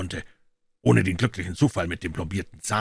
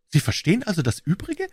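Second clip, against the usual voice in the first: Pycroft's higher voice; 11 semitones higher than usual. A narrator's reading. Pycroft asks Holmes.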